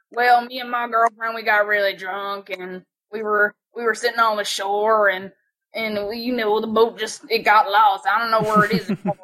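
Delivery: dopey boat owner. Retold or imitated. Boat owner explaining how he lost his boat, low dopey ramble. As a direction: ramble low like a clueless guy.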